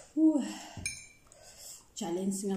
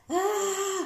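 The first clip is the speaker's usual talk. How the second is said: high pained gasp; 12 semitones higher, 7 dB louder than usual. Real, not acted. Spicy heat.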